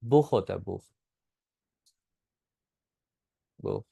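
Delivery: बुख ending in a throaty German ch; fricative kh. Shown as a demonstration. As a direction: repeat बुख as a model, with friction instead of a k. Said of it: "ख की sound होती है".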